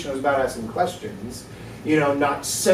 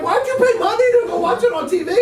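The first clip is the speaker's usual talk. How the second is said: high-pitched incredulous question; baffled; 3 dB louder than usual. Retold or imitated. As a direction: pitch leaps up in mock disbelief. Acting out his baffled reaction to box seats.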